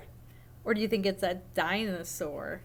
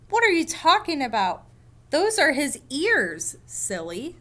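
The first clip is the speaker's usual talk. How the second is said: louder, higher exasperated comeback; indignant retort; 4 semitones higher, 4 dB louder than usual. Acted. Rabbit believer scoffs at the duck believer, louder. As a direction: scoff at the other reader.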